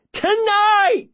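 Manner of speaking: very high, throat-tearing yell; cracking scream. Acted. Boy screaming to the unhearing room, cracking scream. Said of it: "I scream so loud my voice is cracking".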